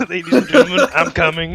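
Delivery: mock announcement while laughing; laughing president impression. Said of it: "a president do an announcement".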